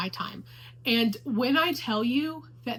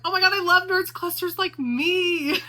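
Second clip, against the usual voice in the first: higher, louder excited squeal; excited gush; 7 semitones higher, 6 dB louder than usual. Retold or imitated. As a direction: burst into your sister's room thrilled. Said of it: "I was like".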